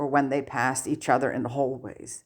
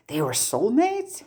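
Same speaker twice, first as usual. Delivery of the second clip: higher, lifting eagerly at the end; eager question; 7 semitones higher than usual. A narrator's reading. Harry eagerly asks Sirius about his parents, higher voice. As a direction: ask it eagerly, hungry to know. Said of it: "Harry asks eagerly".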